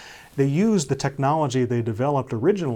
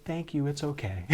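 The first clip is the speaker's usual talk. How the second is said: put-on little girl voice; 2 semitones higher, 8 dB quieter than usual. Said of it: "She'd go".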